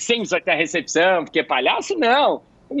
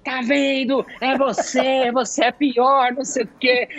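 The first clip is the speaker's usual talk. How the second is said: gloating with a laugh; 5 semitones higher, slower than usual. Retold or imitated. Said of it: "Aí eu".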